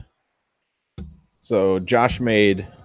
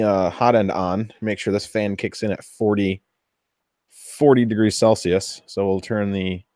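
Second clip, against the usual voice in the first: usual speaking voice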